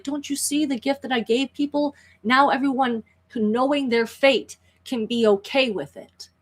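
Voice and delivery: high-pitched begging villain; pleading. Acted. Cornered priest begging for his life, high pleading. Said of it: "literally begging for his life".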